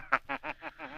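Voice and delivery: high, eerie trailing laugh; the Shadow's taunting laugh; mocking laugh. Shadow mocks the commissioner.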